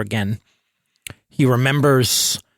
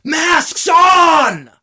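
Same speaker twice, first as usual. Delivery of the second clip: high, loud alarm shout; yelled warning; 7 dB louder than usual. A narrator's reading. Someone yelling over distant shelling, urgent yell. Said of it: "someone yells".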